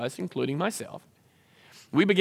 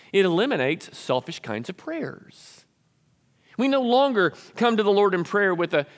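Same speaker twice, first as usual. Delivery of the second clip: ordinary delivery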